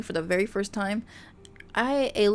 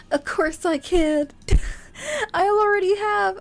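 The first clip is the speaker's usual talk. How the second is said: teary joy; 11 semitones higher, 6 dB louder than usual. Acted. Voices heroine forgiving Kane tearfully.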